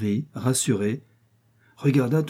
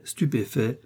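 usual speaking voice

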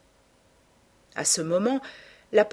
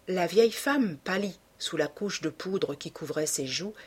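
ordinary delivery

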